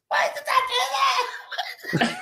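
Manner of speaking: high, slow words through laughter; helpless laughing wail. Retold or imitated. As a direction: squeal it out while laughing. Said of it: "I'm going".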